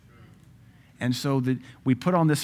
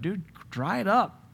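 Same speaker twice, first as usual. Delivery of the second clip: mock scolding; 6 semitones higher than usual. Mocking David's weeping.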